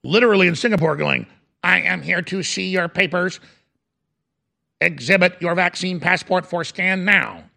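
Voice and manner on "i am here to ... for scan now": monotone robot announcement voice; police robot. Acted. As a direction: speak evenly like a machine giving orders. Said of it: "the robots are rolling out".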